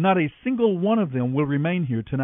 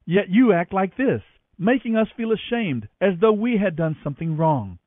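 stern rebuke; 6 semitones higher than usual. A narrator's reading. Joab rebukes the grieving king.

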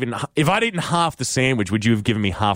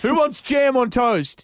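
high, slow mock call to the kids; parent calling out; 11 semitones higher, slower than usual. Co-host joins the sandwich bit, hammed-up parent calling out. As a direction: stretch it out in a put-on high voice.

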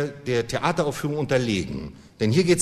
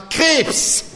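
even higher loud blurt with a laugh; the same guess shouted once more, laughing; second eager shout; 12 semitones higher, 3 dB louder than usual. Acted. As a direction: yell it out again, laughing. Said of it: "rief ich zum zweiten Mal".

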